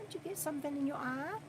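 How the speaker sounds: quick, soft question repeated to the pet; hushed pet talk. Real, not acted. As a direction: ask again fast and hushed.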